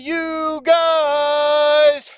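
high, bright call to the viewers; excited greeting. Real, not acted. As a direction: hail your audience with a big hello. Cheery greeting to camera.

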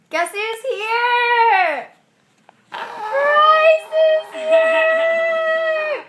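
very high, long-drawn announcement; excited squeal. Pretend. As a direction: squeal the big news, stretching each word.

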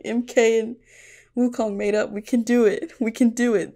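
bright rallying chant of we can do it; hopeful cheer. Retold or imitated. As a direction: pump yourself up with hope. Cheering characters reconciled.

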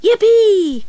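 high, loud joyful yell; excited cry. A narrator's reading. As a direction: shout it like a thrilled cub. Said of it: "cried Euston".

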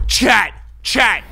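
hyped call out. Real, not acted. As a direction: grab chat's attention. Calling chat over a pull.

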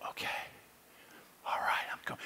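barely voiced, hushed reply; whispered assent. Man answering the voice of Jesus, whispered assent. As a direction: whisper your agreement privately.

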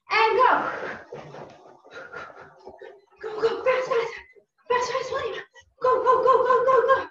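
high, loud rapid-fire urging; excited workout shout; urgent coach cheering. Real, not acted. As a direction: push them through the final sprint, pitched high. Coach urging on last sprint.

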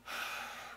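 long, audible meditation breath; demonstrated breath. Shown as a demonstration. Teacher showing breathing with a straight spine, deep breath. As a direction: breathe deeply to show the technique. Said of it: "So when you breathe in".

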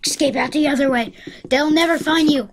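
normal talking voice

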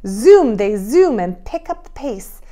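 put-on rhythmic, lively children's verse; sing-song rhyme, building on each zoom; poem read aloud. A narrator's reading.

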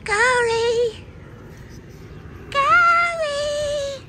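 high, drawn-out name call; sweet calling of the dog. Acted. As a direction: sing the dog's name lovingly.

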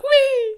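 very high, loud "whee"; high-pitched comic wheee; mock gleeful whee. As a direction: squeal it like being flung through the air. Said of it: "he's just like".

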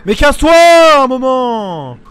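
loud high furious shout, dragged out; angry yell. Real, not acted. Gamer yelling at an annoying player, furious shout. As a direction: yell at the pest to back off.

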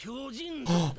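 sharp surprised gasp; soft, startled intake of breath. Real, not acted. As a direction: suck in a quick breath. Reacting to the show.

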